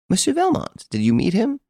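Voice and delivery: high, incredulous echo; astonished question. Acted. Master hears who sent his chauffeur, astonished. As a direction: pitch shooting up in disbelief.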